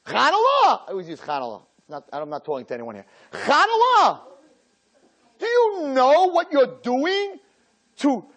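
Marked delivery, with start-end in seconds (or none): 0.1-0.9 high, exasperated parent cry of Chanallah; mock parent gasp. Rabbi mocking a horrified parent's Chanallah. Parent reacting to a bad grade. 3.3-4.2 high, exasperated parent cry of Chanallah; mock parent gasp. Rabbi mocking a horrified parent's Chanallah. Parent reacting to a bad grade.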